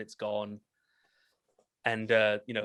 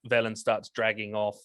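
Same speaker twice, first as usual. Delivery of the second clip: ordinary talk, nothing marked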